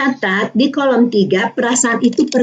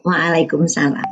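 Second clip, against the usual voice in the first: low, slow, gentle greeting back; slow calm reply; 8 semitones lower, 4 dB quieter than usual. Shown as a demonstration. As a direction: answer the greeting slowly and calmly. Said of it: "Kita bilang apa, Bu?".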